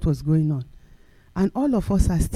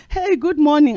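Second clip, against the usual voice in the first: higher, bright Hey, good morning; cheery greeting; 7 semitones higher than usual. Retold or imitated. Preacher showing a kind greeting, higher. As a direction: greet someone kindly.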